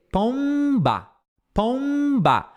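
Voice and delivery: "pomba" high, lips closing before b. Shown as a demonstration. Teacher showing students lips closing. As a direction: stress the lip closure in pomba. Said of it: "vai soltar no B".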